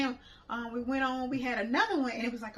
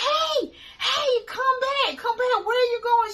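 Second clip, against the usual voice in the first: calling her back; 13 semitones higher, 10 dB louder than usual. Retold or imitated. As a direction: shout after her to come back.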